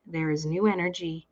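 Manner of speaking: ordinary talk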